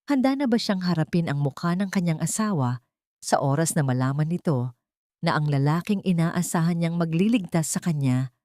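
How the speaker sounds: plain reading voice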